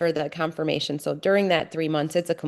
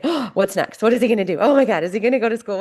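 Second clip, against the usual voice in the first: higher, louder, rushing worried questions; retold panic; 5 semitones higher, 4 dB louder, faster than usual. Mother imagining her son's future, rising panicked questions. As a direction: let the worry spiral faster and faster. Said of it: "it's pure panic".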